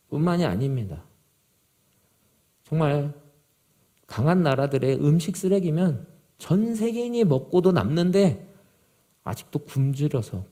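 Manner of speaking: ordinary delivery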